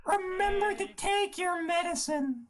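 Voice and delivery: very high falsetto cartoon voice; sing-song squeak in high falsetto; Mickey. Game master teasing the players, high cartoon voice. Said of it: "with the Mickey voice".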